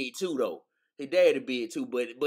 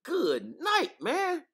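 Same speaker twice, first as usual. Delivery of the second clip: much higher, mock amazed outcry; amazed retelling; 11 semitones higher than usual. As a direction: exclaim in disbelief at his size. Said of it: "I said".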